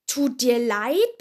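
loud, drawn-out indignant question; booming, incredulous echo; quaking indignation. A narrator's reading. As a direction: throw it back loud and slow, outraged. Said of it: "bebte Hagrid".

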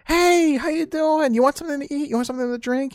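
grandma welcoming a grandchild, high and eager; doting grandma. Retold or imitated. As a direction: play the grandma offering food. Grandma greeting visitors.

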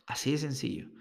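a quiet aside, whispered. Shown as a demonstration. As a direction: whisper it softly, almost breathless. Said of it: "repeats line in a whisper".

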